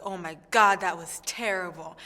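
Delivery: loud gasp of dismay at the first note, then back down; retold dismay. Contestant reliving her first audition note, loud dismayed gasp. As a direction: blurt out the shock of a bad note. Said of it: "and I was like".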